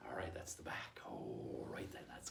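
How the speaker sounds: unvoiced, soft aside; whispered wrap-up. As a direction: hush it to the class. Drops to whisper.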